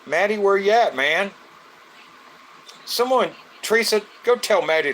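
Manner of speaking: loud, high call across the house; yelling through the house for a friend to join. Real, not acted. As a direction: holler for him to get in here. Calling out for absent friend.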